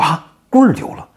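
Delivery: high, loud astonished repeat; surprised echo. Acted. Marshals react to the news.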